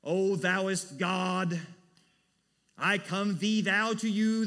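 loud, slow, over-formal mock prayer voice; mock pompous prayer. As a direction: ham up a stiff old-English prayer, loud and grand. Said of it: "Are we approaching him of saying".

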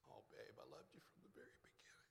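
fast, breathy whispered confession; tender whisper. Retold or imitated. Preacher voicing a lover's answer, soft tender whisper. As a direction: whisper it like a secret to your beloved. Acting tender reply in love.

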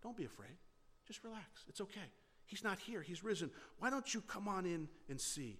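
soft, hushed and tender, coaxing frightened women; gently calm them down, nearly under your breath; a loving angel speaking softly to scared women. Retold or imitated. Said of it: "he said it with a very loving".

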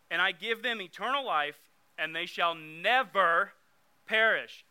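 slow, loud "never" leaning hard on the word; stress on never. Shown as a demonstration. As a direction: hit "never" slowly and loudly. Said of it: "Underline that word "never".

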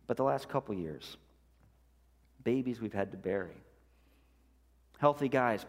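ordinary talk, nothing marked

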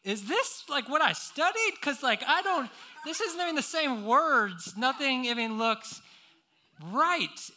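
rising panic over the first question; panicked inner voice. Retold or imitated. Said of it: "I'm like".

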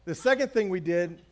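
normal talking voice

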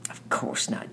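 sharp retort, kept low in volume; sharp answer. A narrator's reading. Annette answers Danny's question, sharply. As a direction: brush the question off tersely. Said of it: "answered Annette sharply".

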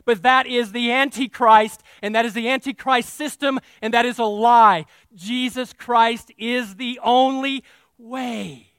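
loud denunciation that slows on the last line; loud preaching rebuttal. Acted. Preaching at full force.

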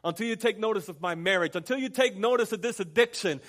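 loud, high, mounting insistence; audacious demand. Acted. Believer demanding that God take notice, loud insistent prayer. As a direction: hammer each repeated plea harder, building. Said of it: "have the audacity to say".

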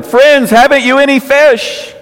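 high-pitched call across the water. Acted. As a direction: shout it out to people far off. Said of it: "He called out to them".

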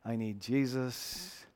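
soft, slow, broken confession; weeping plea. Retold or imitated. The angry man, now weeping, confesses to the preacher. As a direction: let it come out softly, in tears. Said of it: "He's weeping".

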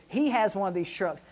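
ordinary talk

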